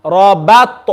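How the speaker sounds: Rabat with a smiled ba, echoed t; smiley ba. Shown as a demonstration. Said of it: "make the ba smiley".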